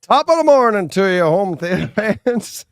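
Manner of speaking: a stage-Irish St. Patrick's Day greeting; Irish accent. Acted. Host greets fans for St. Patrick's Day in a hammed-up Irish accent. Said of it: "That's your accent?".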